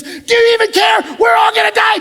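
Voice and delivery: high, loud shout of panic; panicked shout. Retold or imitated. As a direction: yell it like the boat is going under. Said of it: "They look over at Jesus".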